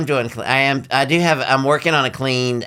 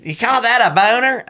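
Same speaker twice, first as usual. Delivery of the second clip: sassy drag queen; 8 semitones higher, 3 dB louder than usual. Real, not acted. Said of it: "you have the sassy queen voice".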